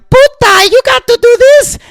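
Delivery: Leguizamo playing his mom, voice jumped way up and loud; high shrill mom voice. Comic telling of his mom nagging, in a high loud voice. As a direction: squeal it like a nagging mother. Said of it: "my mom was all like".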